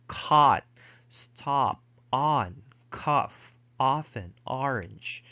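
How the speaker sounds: American "stop", "on", "cough" with open ah; American pronunciation. Shown as a demonstration. Teacher listing examples of American English, American vowel demonstrated. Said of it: "it's more open in American English".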